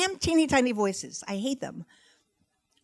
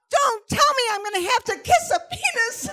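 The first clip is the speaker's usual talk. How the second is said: put-on very high, loud child's wail; horrified shriek; 5 dB louder than usual. Daughter appalled by mom's facts of life, hammed-up shriek.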